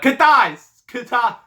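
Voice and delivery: high shouted name, botched; yelling Kitai. Shown as a demonstration. Dad yelling at his son, shouted. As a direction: yell Kitai up high, loud. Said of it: "I botched that".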